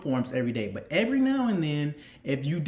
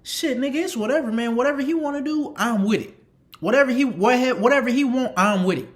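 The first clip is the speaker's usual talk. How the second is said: fake-brave bravado; 7 semitones higher, 5 dB louder than usual. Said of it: "I'm like".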